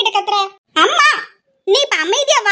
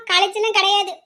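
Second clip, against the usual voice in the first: ordinary delivery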